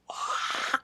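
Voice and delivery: ordinary delivery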